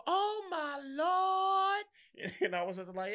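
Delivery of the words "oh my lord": much higher, very slow drawn-out exclamation; astonished teacher. Retold or imitated. Teacher seeing Jamar back at school, drawn-out mock astonishment. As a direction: stretch it out like a shocked church lady. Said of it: "And they go".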